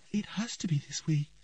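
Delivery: frightened hushed whisper; shaky whisper. A narrator's reading. Ciri whispers to Triss at the chasm, shaky and frightened. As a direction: breathe it out, trembling with dread. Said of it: "Ciri said in a shaky voice".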